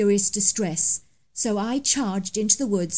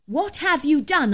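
louder, higher accusation with stress on have; accusing demand; 6 semitones higher, 5 dB louder than usual. Acted. Narrator accusing the poacher, loud demanding question. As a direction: demand an answer, hitting have.

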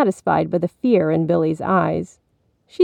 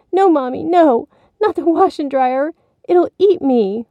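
put-on high, louder child's protest; pleading frightened boy; 9 semitones higher, 4 dB louder than usual. A narrator's reading. Billy carried toward the washer, pleading fearfully. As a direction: beg her not to go closer.

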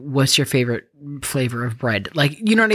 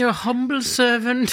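much higher, solemn mock-humble bow; humble servant; 9 semitones higher than usual. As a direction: play a grovelling servant at court. Mock formal prayer continues.

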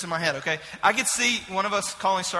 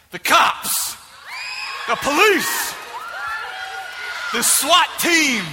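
preacher build; 7 semitones higher, slower than usual. Real, not acted. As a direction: build each one bigger. Building list to climax.

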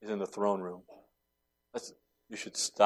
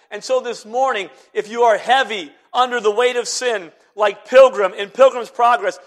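high, loud, ringing pulpit voice; full-force preaching; 10 semitones higher, 9 dB louder than usual. Acted. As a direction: preach it out to the whole church.

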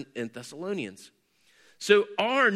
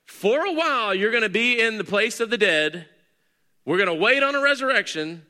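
higher, louder mock voice of the wrong idea; mocking hypothetical; 6 semitones higher, 5 dB louder than usual. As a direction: poke fun at the waiting idea. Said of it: "There was no, like".

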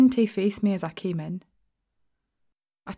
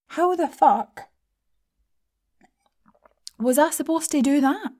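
higher, louder, disbelieving cry; exasperated outburst; 7 semitones higher, 3 dB louder than usual. A narrator's reading. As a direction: throw it up in exasperation. Narrator's exasperated rhetorical outburst.

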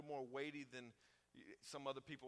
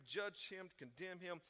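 ordinary talk, nothing marked

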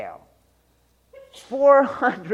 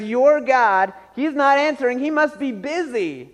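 higher-pitched taunt; mocking Elijah; 6 semitones higher than usual. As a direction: jeer with mock excuse-making. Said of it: "Elijah mocked them".

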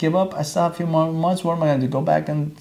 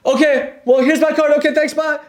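much higher and louder, hurried nervous exit; nervous rush; 12 semitones higher, 5 dB louder than usual. Retold or imitated. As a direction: blurt it out and flee. Scared, runs out of salon.

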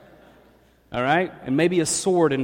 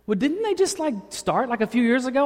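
a raised, doubting passenger voice; high mock passenger voice; 7 semitones higher than usual. Rider doubting the driver's dream, in a higher voice. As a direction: pitch it up as the skeptical rider. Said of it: "passenger questions his joke".

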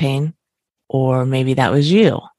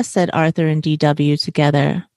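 ordinary delivery

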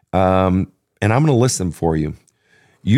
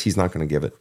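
murmured resignation, much softer; quiet, sinking verdict; 5 semitones lower, 7 dB quieter, much faster than usual. Real, not acted. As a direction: drop to a near murmur on "He's not gonna give it". Repeated verdict, first take dropped.

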